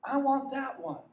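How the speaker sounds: put-on eager child yelling over; boy yelling. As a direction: call out across the field excitedly. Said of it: "he yells over to the farmer".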